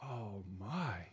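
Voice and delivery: low, hushed, drawn-out wonder; softly overwhelmed; awestruck. Acted. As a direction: breathe it out in reverent awe. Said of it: "Do we still say".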